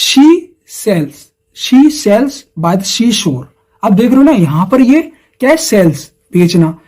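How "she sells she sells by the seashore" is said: she sells by the seashore, each word slow and spaced; teacher reading a tongue twister to the class, slowly and deliberately; slow tongue twister demo. Shown as a demonstration. Sound out "she sells by the seashore" slowly. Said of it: "ये वाला example देखते हैं".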